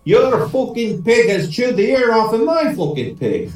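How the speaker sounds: high, slow, hammed-up Irish outrage; storming angry. Paddy accuses his mate's pig, mock angry. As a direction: rant indignantly in an Irish brogue. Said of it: "Paddy stormed into the house".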